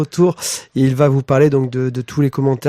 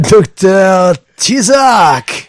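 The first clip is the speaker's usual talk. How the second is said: ring announcer; 6 semitones higher, 7 dB louder, much slower than usual. Acted. Announcing co-host like boxer.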